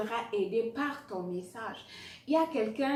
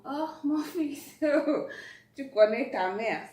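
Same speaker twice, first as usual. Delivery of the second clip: pleading mother; 5 semitones higher, 7 dB louder than usual. Retold or imitated. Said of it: "Puis sa maman est comme".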